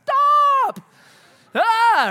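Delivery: loud, very high plea to stop; mock desperate yell. Youth pastor ripping off his mask, yelling desperately. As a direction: yell it high, like someone under a beating.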